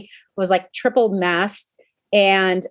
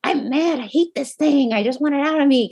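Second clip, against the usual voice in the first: higher, half-laughing mock anger; mock mad; 6 semitones higher than usual. Telling viewers how covid made her mad, in mock anger. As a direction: play up the frustration, not real rage. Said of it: "I got really mad".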